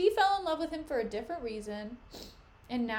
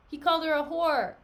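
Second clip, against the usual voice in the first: louder emphatic retort; exasperated retort; 7 dB louder than usual. Acted. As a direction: hammer it home to him. Emilia scolding Iago.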